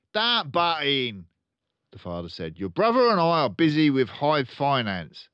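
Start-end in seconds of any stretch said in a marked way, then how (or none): 0.1-1.3 blustering, slow, high car-dealer dad; pompous father voice; loud pompous father. Acted. 2.6-5.3 pompous father voice; loud pompous father. Acted.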